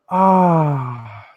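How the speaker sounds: long, hammed-up contented "ah"; satisfied sigh. Savouring a really good viewer comment, long satisfied sigh. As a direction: let out a drawn-out sigh of relief.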